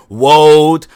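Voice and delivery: put-on Wold with a w for the R, as a child; mispronounced r. Speech teacher hears him say Wold with a w. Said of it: "I'm all like".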